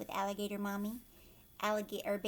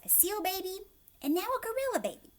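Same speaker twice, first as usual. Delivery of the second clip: put-on high, excited child's voice; bossy little girl; 6 semitones higher, 5 dB louder than usual. Kristen announcing her plan to the family, high and eager. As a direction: announce it like a proud little sister. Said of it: "She's like".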